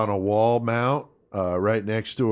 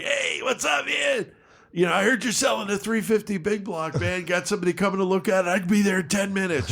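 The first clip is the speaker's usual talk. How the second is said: eager phone greeting; 8 semitones higher than usual. Retold or imitated. Stranger phones about an engine for sale, eager. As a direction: play an upbeat stranger calling.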